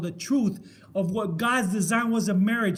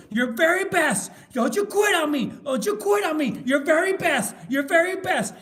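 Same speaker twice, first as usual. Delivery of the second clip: high, loud repeated exhortations; coach urging on; 8 semitones higher, 6 dB louder than usual. Retold or imitated. Film coach to crawling player, urgent yelling. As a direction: drive the struggling player on like a coach.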